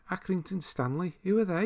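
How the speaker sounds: high-pitched mock Scouse kid from an advert; old advert impression. Quoting an old milk advert to viewers, high-pitched impression. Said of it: "what was that advert for".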